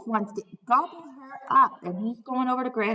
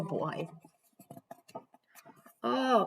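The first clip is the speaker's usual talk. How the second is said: plain reading voice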